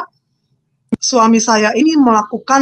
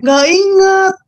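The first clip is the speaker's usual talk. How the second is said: tearful; 8 semitones higher than usual. Real, not acted. Woman telling her conversion story, on the verge of tears. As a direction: voice cracking as she struggles to recall. Testimony of being overwhelmed by God.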